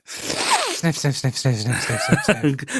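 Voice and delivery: mock snorting sniffs; spoken sniff sound effects; sniffing the air. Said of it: "Good Foley, [name]".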